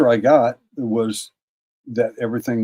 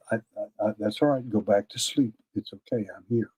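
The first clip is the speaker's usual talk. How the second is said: softly retold words of comfort; quiet, gentle reassurance; soothing reassurance; 7 dB quieter than usual. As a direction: settle her back to sleep in a hushed voice. Soothing a frightened woman to sleep.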